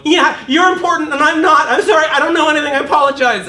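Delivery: high, loud, fast, laughing grovel; high loud groveling whine; groveling self-mockery. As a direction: cringe and apologize in a squeaky voice. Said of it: "And I'm gonna go".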